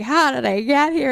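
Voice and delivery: high, loud mock wail; hammed-up despair, wailing in self-pity; very unwell. Man falling to the ground in the retold film, unwell wail. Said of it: "very unwell".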